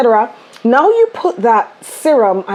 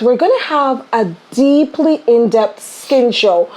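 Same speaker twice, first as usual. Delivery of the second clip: normal talking voice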